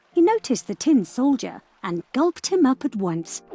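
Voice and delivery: ordinary delivery, nothing marked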